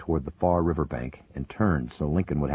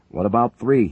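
loud, higher protest; shouting; 7 semitones higher, 8 dB louder than usual. A narrator's reading. Co-pilot demanding they save the crew, shouting. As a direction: shout the question over the rotors. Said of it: "Higgins shouted".

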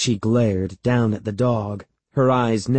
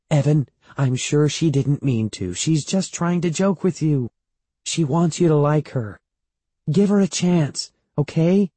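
higher-pitched coaxing; smooth things over, pitch climbing; placating mother; 6 semitones higher than usual. A narrator's reading. Mother talking her upset son round, higher and coaxing.

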